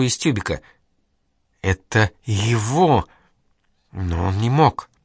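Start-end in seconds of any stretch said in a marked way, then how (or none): 1.6-4.9 slow, strained, squeezed out; forcing the words out. Acted. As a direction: push each word out with effort. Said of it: "выдавила слова с усилием".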